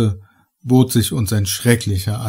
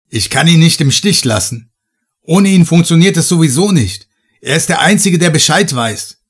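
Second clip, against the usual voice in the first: urgent shout; 5 semitones higher, 4 dB louder than usual. Acted. Heiner shouting his refusal across.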